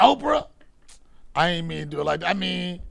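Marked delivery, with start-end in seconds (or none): 0.0-0.5 loud, high-pitched incredulous question; taken aback and much louder; startled disbelief. Real, not acted. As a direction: blurt it out in disbelief. Reacting to a misheard name.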